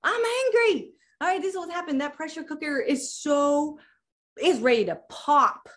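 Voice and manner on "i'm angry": much higher, firmly declaring I'm angry twice; high, forceful admission of anger; emphatic declaration. Shown as a demonstration. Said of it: "this is when you acknowledge".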